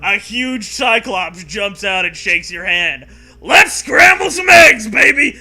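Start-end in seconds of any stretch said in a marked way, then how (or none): 3.4-5.3 high, loud sneering yell; cartoon monster hollering at the hero; sneering shout. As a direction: bellow it like a crazed Cyclops. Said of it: "he sneers".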